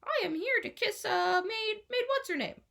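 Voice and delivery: high put-on stranger voice; disguised stranger. A narrator's reading. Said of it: "he says".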